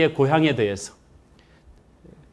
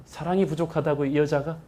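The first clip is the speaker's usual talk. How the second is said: ordinary talk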